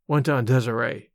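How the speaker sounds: usual speaking voice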